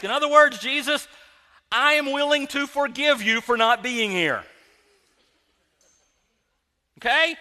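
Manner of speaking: loud, high, grudging forgiveness; indignant shout with slow, punched words; angry accusing Martha. Acted. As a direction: forgive him while still yelling at him. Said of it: "that's how she said it".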